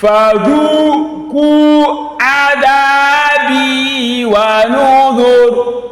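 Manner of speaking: melodic tajweed chant with long held vowels; Quran recitation. Shown as a demonstration. Teacher modelling verses for students, melodic tajweed chant. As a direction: intone each verse slowly and musically, like a reciter.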